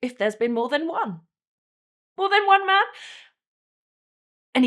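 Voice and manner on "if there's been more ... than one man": pained question. Acted. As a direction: build from a held-back question to a loud, high repeat. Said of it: "And then I painfully ask him".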